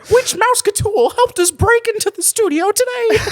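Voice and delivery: very high falsetto mouse squeak; sky-high cartoon host quizzing the audience; Mickey. Asking about a studio break-in in Mickey's voice. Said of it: "I prefer my Mickey accent".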